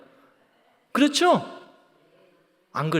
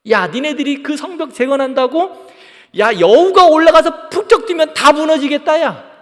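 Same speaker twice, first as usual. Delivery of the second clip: loud, scornful taunt; mocking jeer; 4 semitones higher, 7 dB louder than usual. Preacher acting enemies ridiculing the wall rebuilders, mocking. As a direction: sneer at their feeble building effort. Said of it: "비웃는 거야, 조롱하는 거야".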